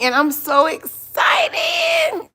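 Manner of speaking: very high, drawn-out so excited; squealing with excitement. Real, not acted. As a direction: squeal it out with glee. Announcing the cooking live.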